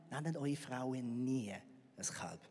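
slow, conspiratorial whispered warning; hushed aside, half-joking. Real, not acted. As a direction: lean in and whisper the advice to the men. Confiding tip to all men.

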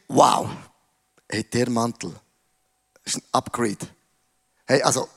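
low, hushed, marveling to himself; a young man dazzled by a new coat, murmured low; low hushed voice of Josef admiring his coat; Josef's awed inner voice. Acted.

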